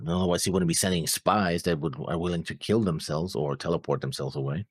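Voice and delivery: normal talking voice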